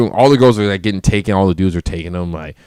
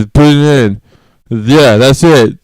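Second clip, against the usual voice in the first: put-on slow, slurred and louder drunk voice; drunk slurring; 3 semitones higher, 6 dB louder, slower than usual. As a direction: drag the words out like you've had too many. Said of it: "slurring my words and shit".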